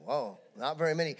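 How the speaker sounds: normal talking voice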